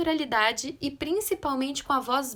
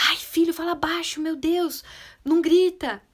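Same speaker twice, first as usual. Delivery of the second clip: louder, higher mock pleading with a child; a parent's exasperated outburst, acted; 4 semitones higher, 4 dB louder than usual. Parent begging a screaming child to hush, exasperated.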